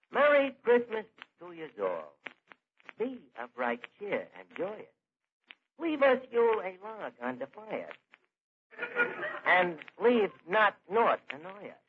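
slow, measured mock-grand verse reading; poem recitation. [name] reads his annual Christmas poem to Eddie, grandly reciting. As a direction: declaim homemade verse with pauses between lines.

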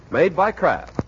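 ordinary delivery

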